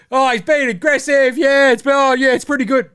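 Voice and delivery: high-pitched mocking imitation; mocking pundit. Host mimicking Chris Paul's empty halftime insight, mocking. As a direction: parody vague punditry in a high voice.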